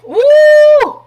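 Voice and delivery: high, loud whoop of the ego; mock whoop. Her ego hyping itself up with a high, loud whoop. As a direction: let out a big high whoo. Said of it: "Now my ego".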